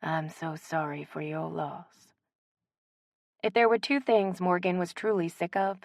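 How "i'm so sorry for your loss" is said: soft, slow condolence; softer, slow mournful sympathy. Acted. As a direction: murmur it like a sad mourner. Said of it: "a mournful chorus".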